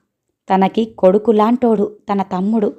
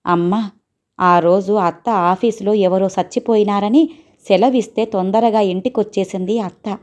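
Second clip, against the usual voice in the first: ordinary delivery, nothing marked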